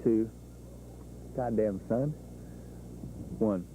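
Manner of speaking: ordinary talk, nothing marked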